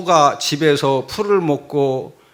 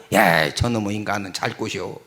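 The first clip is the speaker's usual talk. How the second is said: muttering onlooker; 10 semitones lower, 5 dB quieter than usual. Acted. Quotes an onlooker's remark.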